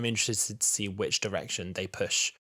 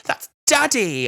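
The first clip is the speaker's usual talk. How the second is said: put-on high, slow, overjoyed child's shout; a hammed-up little girl spotting her dad; mock child's cry; 13 semitones higher, 8 dB louder, much slower than usual. Podcaster mocking the child seeing her dad, hammed-up high cry. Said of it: "she's like".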